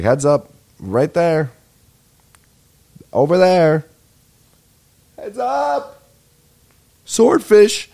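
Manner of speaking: a mock panicked heads-up, building to a yell on swordfish; excited warning shout. Retelling the Get Smart golf course scene, mock yelling heads-up. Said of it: "he's like".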